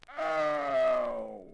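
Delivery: long, loud, high "ow"; pained howl. Acted. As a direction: howl as the pulled bear. Bear yanked, cries in pain.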